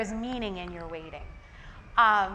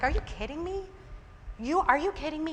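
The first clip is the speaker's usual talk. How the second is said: high-pitched acted disbelief; incredulous; 7 semitones higher, 3 dB quieter than usual. God reacts to Israelites worshipping another god, incredulous.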